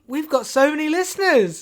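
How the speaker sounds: high-pitched, giddy excitement; thrilled. Acted. Host spotting the livestream audience, thrilled and high-pitched.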